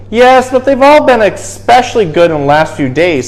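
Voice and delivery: lightly voiced elf making excuses; eager little elf. A narrator's reading. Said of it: "said the elf".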